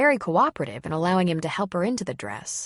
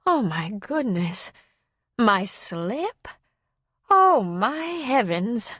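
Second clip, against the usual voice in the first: slow, rising, shocked "Oh, my heavens"; mild slow-drawn shock of a genteel old lady; dismayed old woman; 4 semitones higher, much slower than usual. A narrator's reading. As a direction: gasp it out in dismay, slowly. Old woman shocked at her state.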